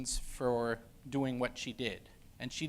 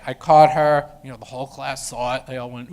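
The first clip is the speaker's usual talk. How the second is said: ordinary talk, nothing marked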